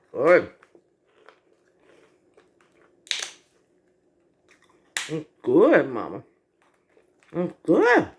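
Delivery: talking while chewing; words round a bite of food; mouth full. Real, not acted. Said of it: "I'm talking with my mouth full".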